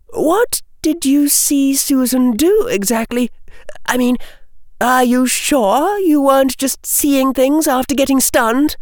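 put-on high girlish character voice; gently doubt the witness, pitched high; questioning schoolgirl. Hannah asking what Tracy saw, high questioning voice.

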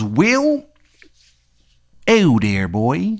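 drawn-out, swooping bad Maryland accent; badly faked Maryland accent; Shithead Steve's Maryland accent. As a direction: stretch it out in a hammed-up Maryland twang. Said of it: "he's got, like, a terrible Maryland accent".

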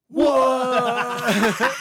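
very high, loud, laughing what; screaming disbelief at the delayed TV; big shocked what. Retold or imitated. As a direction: yell it like the bar just exploded. Reliving the second reveal, bar erupts.

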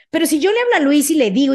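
ordinary talk

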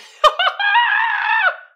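very high, loud screaming laugh; shrieking laugh. Real, not acted. Host shrieking with laughter at the caption.

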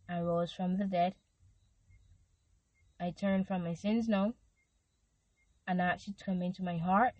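measured prayer read in short phrases with long pauses. Acted. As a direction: lead the listener line by line through a prayer. Leads sinner's prayer for repetition.

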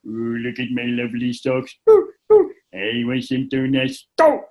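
goof it up like a cartoon dad to cheer her up; hammed-up cartoon character voice; silly cartoon dad voice. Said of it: "I turned around to her and I went".

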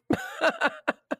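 short breathy laugh. Real, not acted. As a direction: laugh it off lightly. Laughs at her lost voice.

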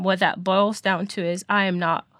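ordinary delivery

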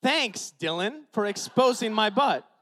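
mock-bitter jab at Dylan; sarcastic anger. Pastor sarcastically thanking Dylan, loud and slow. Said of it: "I punched the wall".

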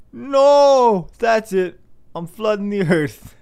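high, loud hammed-up rage; mock furious God. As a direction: blow up as an outraged God.

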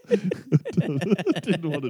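very high, slowed by laughter; laughing while talking. Real, not acted. Host cracks up at his own curry pun, laughing. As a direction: squeeze the words out through laughter.

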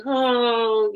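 a high, loud, melodramatic "Oh"; tragic wail. Retold or imitated. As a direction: overdo it like a tragic heroine. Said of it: "Everything was like a big".

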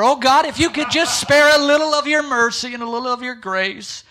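high, loud, hammed-up groveling old man praying; mocking a self-abasing old man at prayer; mocking old man's prayer. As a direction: ham up an old churchman begging God. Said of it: "He would go".

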